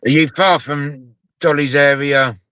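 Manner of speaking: normal talking voice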